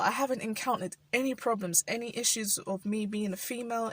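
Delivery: ordinary talk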